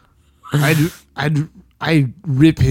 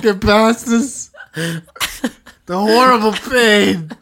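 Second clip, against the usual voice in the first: hammed-up mock anguish; 6 semitones higher, slower than usual. Hams up pain from dry throat.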